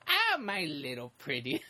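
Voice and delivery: slow, drawn-out witchy croon; mock villainess luring a child; Wicked Witch. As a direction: imitate a storybook witch, savoring each word. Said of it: "When the Wicked Witch goes up to Ralph".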